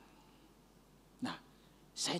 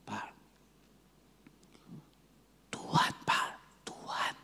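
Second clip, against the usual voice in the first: mysterious whisper; 4 semitones higher than usual. Retold or imitated. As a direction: whisper it like a holy secret. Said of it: "kemudian merubah, saudara, suaranya".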